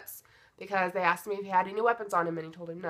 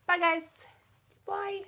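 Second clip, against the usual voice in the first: high-pitched goodbye; 13 semitones higher, slower than usual. Real, not acted. High sign-off with dog.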